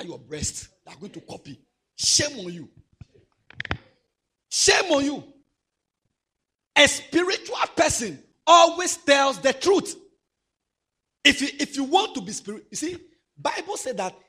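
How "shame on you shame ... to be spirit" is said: shouted preaching. Acted. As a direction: thunder it at the children. Preaching at full force.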